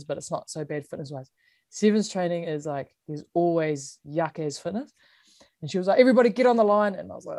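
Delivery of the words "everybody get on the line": mildly raised coach call; coach's call. Retold or imitated. Coach to players before fitness, mildly shouted order. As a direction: call the team in, higher and a bit louder.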